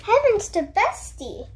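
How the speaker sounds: mild Owl surprise; surprised Owl. A narrator's reading. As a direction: gasp it out as startled Owl. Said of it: "Owl said".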